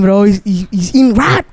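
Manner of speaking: normal talking voice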